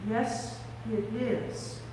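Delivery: very soft, halting wonder; hushed recognition. Acted. Mary first recognising Jesus, in a hush. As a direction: say it under your breath, awed.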